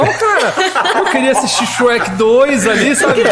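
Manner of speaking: high, loud, through laughter; worked-up, laughing confession; laughing exclamation. Real, not acted. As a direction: blurt it out amid laughs, pitch way up. Laughs recounting his crying fit.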